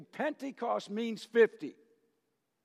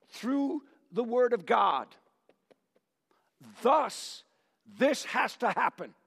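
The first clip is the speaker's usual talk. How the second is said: Peter preaching; 5 semitones higher, 3 dB louder than usual. Acted. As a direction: announce it like Peter commanding the people to listen. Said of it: "Peter who stands up and says to the people".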